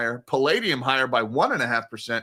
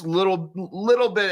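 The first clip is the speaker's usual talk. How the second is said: "little" pitched up high, then repeated; stress on LITTLE; 5 semitones higher than usual. Shown as a demonstration. Host downplaying the risk off, emphasis on "little". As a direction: lean on "little" with a raised pitch. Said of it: "emphasis, a little bit".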